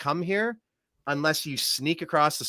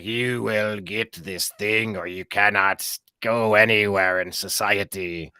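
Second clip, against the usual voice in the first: put-on slow, low German-accented official; Klaus Schwab accent; 5 semitones lower, slower than usual. Host mocking ghoulish health ministers, Klaus Schwab German accent. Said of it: "like, a Klaus Schwab accent".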